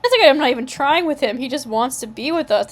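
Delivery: ordinary delivery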